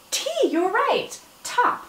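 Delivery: ordinary talk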